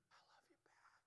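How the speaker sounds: hushed, breathy whisper back; whispered reply. Acted. The heart whispering love back to God. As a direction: whisper it like a secret answer.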